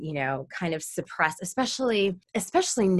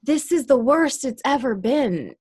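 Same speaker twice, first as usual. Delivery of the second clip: mock liberal; 6 semitones higher, 3 dB louder than usual. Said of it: "newly woke liberals that are like".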